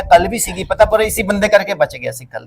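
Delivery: ordinary delivery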